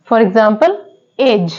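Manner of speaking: ordinary delivery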